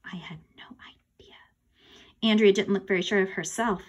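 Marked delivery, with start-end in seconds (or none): 0.1-1.4 very faint, low whisper; breath-only admission to the listener; hushed aside. A narrator's reading. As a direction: whisper it almost inaudibly. Narrator's hushed, clueless aside.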